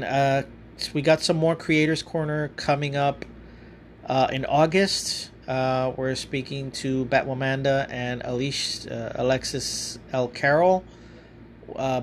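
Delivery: ordinary delivery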